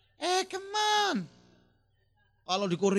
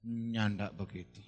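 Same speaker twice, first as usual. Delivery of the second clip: put-on slow, slurred drunk reply, lower; drunk husband; 12 semitones lower, 8 dB quieter, slower than usual. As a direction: lazily brush it off like a drunk. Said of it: "Suaminya dengan mabuk jawab". (suami mabuk menjawab pelan dan malas)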